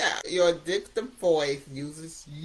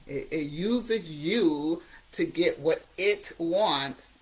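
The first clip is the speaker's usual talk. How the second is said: ordinary delivery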